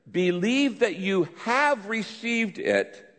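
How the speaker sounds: "have" lifted high in a slow reading; slow scripture reading with "have" punched; stress on have. Shown as a demonstration. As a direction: read slowly, leaning hard on the word "have". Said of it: "emphasize the word have".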